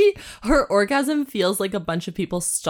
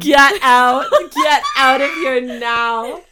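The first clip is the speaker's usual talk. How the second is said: high, loud comic yell breaking into laughter; mock outraged shout; 5 semitones higher, 7 dB louder, slower than usual. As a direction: shout it in playful disbelief. Mock outrage at the story's line.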